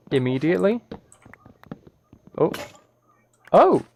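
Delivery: ordinary talk, nothing marked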